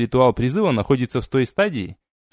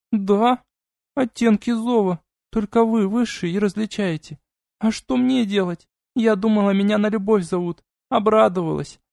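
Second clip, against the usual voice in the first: put-on hurt young demoness; 8 semitones higher than usual. A narrator's reading. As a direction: pout it out, genuinely hurt. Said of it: "искренняя обида и разочарование".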